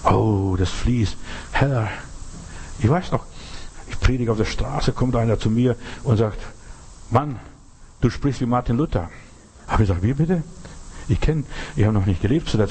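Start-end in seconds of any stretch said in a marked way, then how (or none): none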